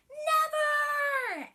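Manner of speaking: high, loud cry of refusal; shouted. Acted. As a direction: scream it like a tantrum. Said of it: "gave a big shout".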